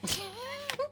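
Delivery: a short high burst of laughter. Real, not acted. Mom laughing at her son's excuse, high laugh.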